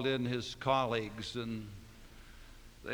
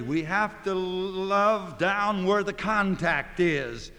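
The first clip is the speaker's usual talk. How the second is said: slow, rising to a much louder, higher climax; building to full preaching; 7 semitones higher, 12 dB louder, slower than usual. Acted. Preacher to congregation, climbing to a loud, slow climax. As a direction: preach it up to a ringing peak. Sermon climax on loving sinners.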